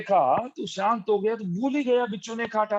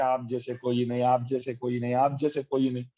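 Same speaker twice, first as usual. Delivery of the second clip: mock flattery; 6 semitones lower than usual. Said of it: "चढ़ाते, चढ़ाते".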